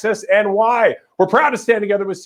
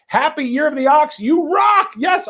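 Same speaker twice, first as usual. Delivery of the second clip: excited shout; 10 semitones higher, slower than usual. Real, not acted. Host cheering a donor's message, excited shout. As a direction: whoop it up for the donors.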